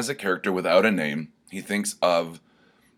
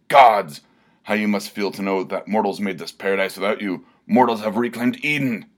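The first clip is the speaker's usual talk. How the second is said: bellowing at the gods; 3 semitones higher, 5 dB louder than usual. Acted. Barbarian taunts the gods, loud bellow. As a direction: roar it up at the heavens. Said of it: "he bellowed".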